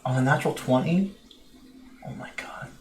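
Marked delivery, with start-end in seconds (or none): 2.1-2.7 breathless whispered disbelief; whispering. Real, not acted. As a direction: whisper it in shock. Reacts to hobgoblin's reply.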